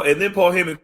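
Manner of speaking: normal talking voice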